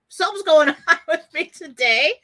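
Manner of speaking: laughing it off, loud; words broken up by real laughter; giggling through a flustered admission. Real, not acted. Laughs at her own emotions.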